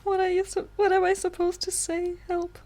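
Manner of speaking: high, flustered plea; panicked pleading for help. Real, not acted.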